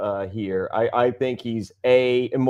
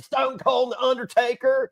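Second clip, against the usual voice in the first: put-on much higher old-lady voice; grandma voice; 9 semitones higher than usual. Imitating grandma naming her favorite wrestlers. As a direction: answer like a proud grandma. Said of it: "She's like".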